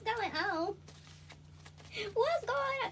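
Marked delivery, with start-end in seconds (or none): none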